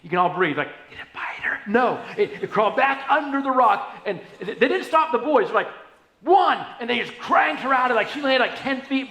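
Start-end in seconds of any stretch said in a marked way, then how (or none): 0.9-1.6 whispered, worried question; anxious whisper. Retold or imitated. Listeners' imagined anxious question. 6.2-6.6 loud, higher shout of the final number; shouted count. Retold or imitated. Boys yank girl on count.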